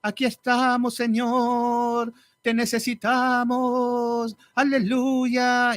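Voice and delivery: high, very slow tearful crooning; weepy worship leader parody. Pretend. Mocking worship leaders who sing while crying, high slow teary croon. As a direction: croon it like a sobbing worship song. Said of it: "alabancistas que hablan llorando".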